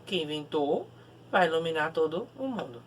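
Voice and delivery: ordinary talk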